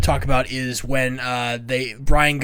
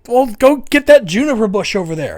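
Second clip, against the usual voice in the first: annoyed Brian; 7 semitones higher, 5 dB louder than usual. Retold or imitated. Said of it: "And it's like".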